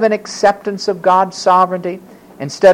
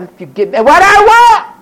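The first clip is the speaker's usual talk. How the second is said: high, loud mock yell; demanding bargain; 12 semitones higher, 6 dB louder than usual. People bargaining with God, demanding yell. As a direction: burst out with the demand.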